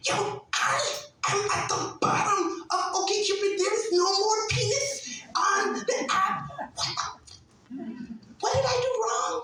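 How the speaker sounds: high, near-tears comic panic; hammed-up high distress; mock panic. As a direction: squeal it like you're about to cry. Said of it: "I was gonna cry".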